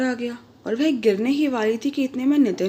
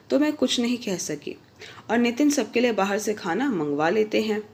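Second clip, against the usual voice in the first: ordinary delivery, nothing marked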